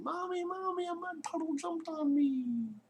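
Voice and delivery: put-on high, panicked little girl calling mommy; child yelling for mommy. Little girl runs inside yelling to her mother, high and panicked. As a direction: cry out for mommy like a scared child. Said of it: "Julianne ran inside yelling".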